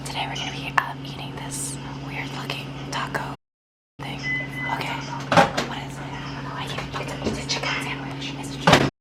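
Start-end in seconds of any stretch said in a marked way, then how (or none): 0.1-7.3 soft unvoiced ASMR whisper. Shown as a demonstration. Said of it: "I wanna do an A S M R". 7.3-8.3 unvoiced ASMR whisper. Shown as a demonstration. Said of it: "I wanna do an A S M R".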